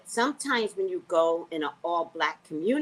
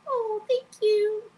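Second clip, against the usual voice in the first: mock crying thanks; 5 dB louder, much slower than usual. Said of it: "That's me".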